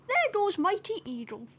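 plain reading voice

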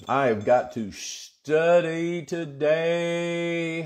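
slow, elongated declaration of urgency; drawn-out emphasis. Real, not acted. Pastor telling congregation he must study, drawn-out emphasis. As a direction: stretch the words out slowly, holding the vowels.

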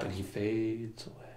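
slow, trailing into near silence; fading away. Shown as a demonstration. Telling how a friend disappears, fading out. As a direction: let the voice dissolve as it goes. Voice fades with the character.

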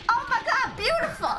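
high, thrilled exclaim; delighted. Real, not acted. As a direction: gush over it. Delighted at game.

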